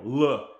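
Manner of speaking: L said as a heavy "luh"; thick Slavic L. Shown as a demonstration. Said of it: "their L's are very thick".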